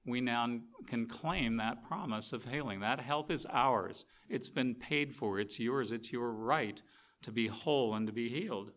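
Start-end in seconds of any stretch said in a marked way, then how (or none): none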